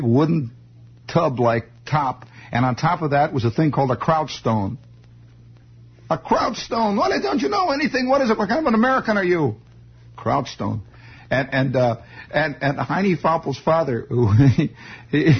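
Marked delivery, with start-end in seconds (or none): 6.1-9.6 high-pitched, incredulous mock scolding; mock outrage. Scolding a listener who doesn't know kraut stones, mock outrage. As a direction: scold them in high, put-on disbelief.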